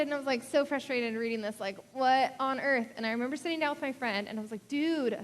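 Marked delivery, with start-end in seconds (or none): 4.7-5.2 higher, louder laid-back "Dude"; a casual college-guy greeting, higher and louder. Retold or imitated. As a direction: drop into the old college-buddy "Dude". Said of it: "that was my college voice".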